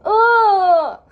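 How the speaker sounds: loud, high groan with a laugh; exasperated shout of frustration; frustrated groan. Real, not acted. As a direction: let out a loud exasperated ugh. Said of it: "I'm so upset".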